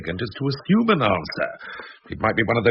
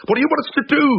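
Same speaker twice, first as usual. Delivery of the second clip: exasperated; 4 dB louder, much faster than usual. Acted. Exasperated reply in quarrel.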